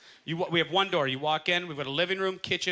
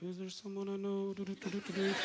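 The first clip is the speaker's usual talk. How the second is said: murmured under the breath, much softer; quiet inner thought; 12 dB quieter than usual. Retold or imitated. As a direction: mumble it to yourself while scanning the room. Inner voice scanning a room.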